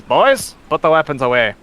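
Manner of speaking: loud, firm order; commanding call. Acted. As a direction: call the boys to heel. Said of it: "And she says".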